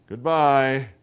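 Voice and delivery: hearty on the surface, relieved to see them go; false heartiness. A narrator's reading. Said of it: "with false heartiness".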